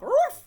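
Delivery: sudden loud, high-pitched woof; dog bark. Acted. Partner willing to do whatever you want, barking like a dog. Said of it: "Bark like a dog".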